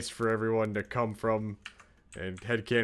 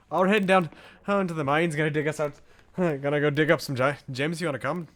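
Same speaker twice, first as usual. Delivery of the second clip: chirpy Minnesotan dwarf heading to the mines; a light Minnesota accent, upbeat and folksy; 3 semitones higher, 5 dB louder, faster than usual. Pretend. Streamer voices a Minnesotan dwarf inviting you mining. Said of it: "Oh, they're Minnesotan".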